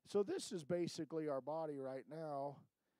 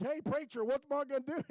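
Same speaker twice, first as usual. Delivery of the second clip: mock panic; 8 semitones higher, 6 dB louder than usual. Panicked call to his preacher.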